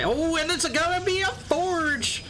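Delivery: high, slow burst of surprise; surprised exclaim. Real, not acted. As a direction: catch the reveal with a high cry. Surprise reveal in cast.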